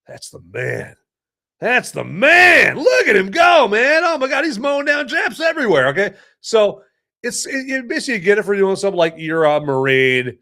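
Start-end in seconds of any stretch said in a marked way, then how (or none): 0.1-0.9 low, soft awed murmur; hushed awe. Retold or imitated. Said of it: "you're like". 1.6-5.9 excited shout. Retold or imitated. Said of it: "you're like".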